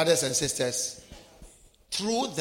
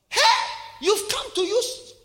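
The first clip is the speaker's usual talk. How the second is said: high, louder mocking call; retold shout; 13 semitones higher, 3 dB louder than usual. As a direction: jeer across the restaurant. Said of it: "A man saw me and shouted".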